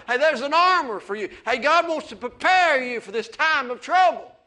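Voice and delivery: fiery pulpit preaching; preaching at full force. Acted. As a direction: build the sermon up to a ringing shout.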